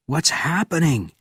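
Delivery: high, urgent call; shouted question. A narrator's reading. Benjamin leaping from the jeep, calling out high. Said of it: "shouted Benjamin".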